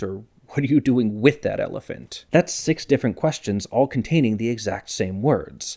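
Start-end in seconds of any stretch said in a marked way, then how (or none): 0.5-2.2 accent lands on WITH, rising at the end; stress on WITH. Shown as a demonstration. Comparing six questions for viewers, stress on WITH. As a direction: punch WITH before that elephant. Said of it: "where you place the accent".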